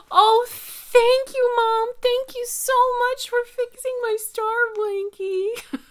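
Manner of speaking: put-on high, loud, squealing child voice; grateful little girl. Mom imitating her daughter thanking her for the mended blankie, high excited child voice. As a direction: gush like a thrilled four-year-old. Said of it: "She goes".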